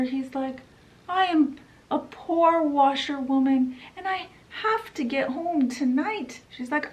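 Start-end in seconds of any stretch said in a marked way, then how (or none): 1.1-6.4 higher, put-on old washerwoman voice; Toad as washerwoman. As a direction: play a meek poor washerwoman.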